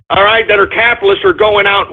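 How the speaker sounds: ordinary delivery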